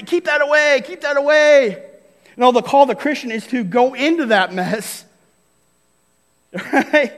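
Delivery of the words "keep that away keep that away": higher, pushing it away in disgust; a prim, squeamish keep-away; mock recoiling. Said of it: "It's not to say".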